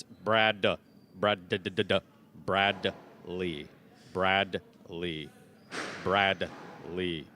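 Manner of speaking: Bradley split as "Brad... lee", hard D; careful enunciation. Shown as a demonstration. Announcer correcting Brian, Bradley pronounced in two separate, careful pieces. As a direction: spell out Bradley by sound, a clear D before the lee. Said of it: "Brad with a D".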